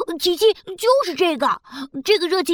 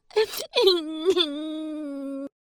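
soft sob; 7 dB quieter than usual. Acted. Alien weeps, is told don't worry.

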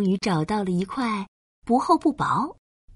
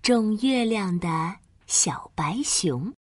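story title whisper. A narrator's reading. Title read softly. (轻声耳语读标题)

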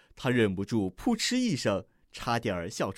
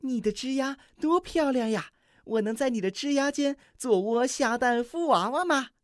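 高亢欢快的小鸟声; 尖着嗓子开心地夸; 乐呵呵的梅花雀; 10 semitones higher than usual. A narrator's reading. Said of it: "乐呵呵地说". (梅花雀高声乐呵呵地夸赞)

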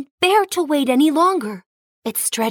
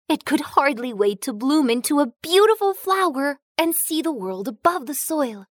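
ordinary delivery

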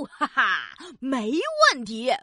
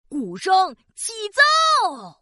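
slow, drawn-out proclamation; grand announcement; 6 semitones higher, 3 dB louder, slower than usual. Acted. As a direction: announce it like a showman. Magician starting the drum act.

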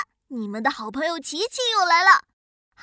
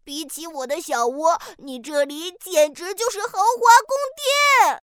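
amazed; 5 semitones higher, 4 dB louder than usual. Acted. Duck amazed by house.

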